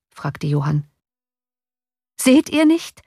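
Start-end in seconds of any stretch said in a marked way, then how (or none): 2.2-3.0 high, loud, desperate question; desperate cry. A narrator's reading. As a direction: cry out in despair at the oncoming crowd. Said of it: "rief der Kutscher verzweifelt".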